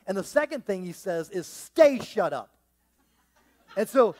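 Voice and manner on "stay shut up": louder, slow stay shut up; slow firm command. Acted. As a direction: lay down the final word. Jesus commands the storm.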